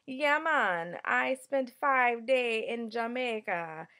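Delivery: slow, loud, playful put-on Jamaican accent; loud, mock Jamaican island greeting; horrible Jamaican accent. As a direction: ham up a bad Jamaican accent, drawn out. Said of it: "That's my horrible Jamaican accent".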